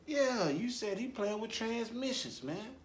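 high, slow, teasing; mocking high tone. Real, not acted. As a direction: drag it out in a high mock voice. Mocking the rumor.

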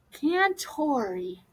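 soft, very slow whisper; whispered, savouring. A narrator's reading. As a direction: roll the name slowly over your tongue. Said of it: "he whispered".

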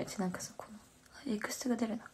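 very soft unvoiced whisper; whispered aside about extensions. Real, not acted. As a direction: breathe it out privately. Hushed aside about hair extensions.

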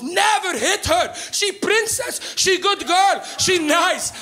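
high, slow broken English of an immigrant father; dad scolding in accented English. Retold or imitated. Father defending his granddaughter in a high accented voice. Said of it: "He goes".

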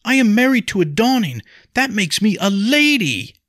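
loud, high indignant shout; called out. A narrator's reading. Wife calling her rank after Henry, indignant shout. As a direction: proclaim it defiantly at the top of your pitch. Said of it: "she called".

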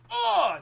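high, loud acted cry; sudden whoop shooting way up; explosive high "oh"; loud exclaimed "oh". Villain strikes the next man.

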